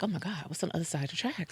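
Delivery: very soft, under-the-breath reaction; hushed aside. Retold or imitated. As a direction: drop right down for a private mock reaction. Retelling own hushed reaction to neighbours.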